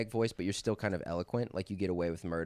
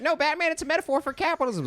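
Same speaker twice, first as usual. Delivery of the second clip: much higher, louder put-on Joker; 6 dB louder than usual. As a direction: squeal it like a lecturing villain. Joker voice in acted bit.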